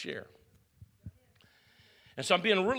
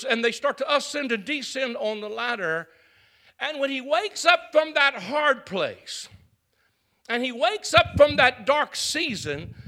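louder and higher, building to a roar; preaching at full force; 7 semitones higher, 16 dB louder than usual. Acted. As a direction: climax of a sermon.

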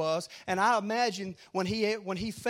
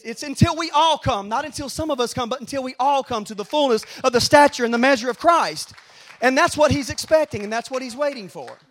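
loud, raised, emphatic pulpit delivery; full-force preaching; 6 semitones higher, 12 dB louder than usual. Real, not acted. Preacher telling the congregation we all must come, full-force preaching. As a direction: preach it out loud to the whole room.

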